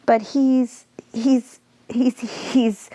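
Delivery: a teacher stumbling, repeating he's, he's; stuttering. Acted. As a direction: get stuck on he's, unsure what to say. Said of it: "Mister P stuttered".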